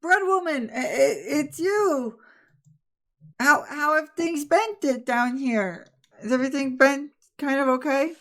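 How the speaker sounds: put-on high, stammering young voice, near tears; Riley's voice. Riley meeting the bread woman again, high and stammering. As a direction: stammer it out, excited and teary. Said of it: "he goes".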